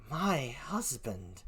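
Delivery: very slow, low and quiet; stunned echo. Acted. Emilia repeats the news to herself, stunned. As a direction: let the words out softly in shock.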